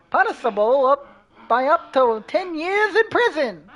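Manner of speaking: laughing, high loud reporter voice; news reporter voice. Acted. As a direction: crack up mid-report. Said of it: "news reporter voice".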